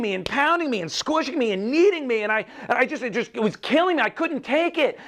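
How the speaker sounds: high, agitated storytelling voice; talking teacup. A narrator's reading. Teacup telling the couple of being pounded, high and agitated. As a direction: voice a distressed little teacup, pitched well up. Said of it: "And then the teacup starts speaking".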